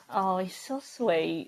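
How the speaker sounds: low, soft, slow cooing; mock doting. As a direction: coo it like a charmed fan. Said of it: "have the response of".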